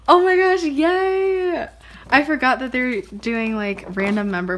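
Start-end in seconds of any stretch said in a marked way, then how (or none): 0.1-1.7 high, loud burst of delight; thrilled high-pitched cheer; excited squeal. Real, not acted. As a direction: squeal with joy at a lucky surprise. Reacts to the poster.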